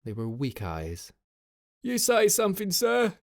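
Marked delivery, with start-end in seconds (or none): 1.8-3.2 high, loud screech from a coarse sergeant; screeched reply. A narrator's reading. Sergeant screeching back at his officer, high and loud. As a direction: screech it back over the wind. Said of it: "he screeched back".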